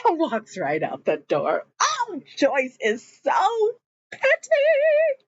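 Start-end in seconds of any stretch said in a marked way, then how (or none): 1.8-5.2 high, drawn-out gossipy exclamation; exasperated delight. Real, not acted. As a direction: stretch it out in high-pitched disbelief. Teller exclaims over character's pettiness.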